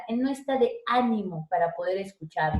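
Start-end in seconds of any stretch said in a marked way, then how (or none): 0.9-1.5 ÁNIMO with the force on Á; first-syllable stress. Shown as a demonstration. Teacher showing an esdrújula, stress on Á. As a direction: hit the opening syllable of ÁNIMO. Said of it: "una esdrújula".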